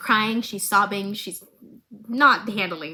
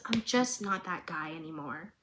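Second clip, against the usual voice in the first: a hushed, much softer line; quiet resigned; 5 semitones lower, 10 dB quieter than usual. Retold or imitated. Vander telling Silco he has changed, hushed. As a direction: let the words fall away, defeated.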